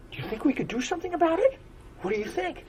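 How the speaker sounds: put-on very high, fast childlike voice; young unicorn eagerly asking the frog; eager little unicorn. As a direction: rush it out like a hopeful child.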